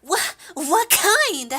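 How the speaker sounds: high, loud, slow stammered question; flustered character. Acted. Club member asked about food, flustered. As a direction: stammer it out in a squeaky voice.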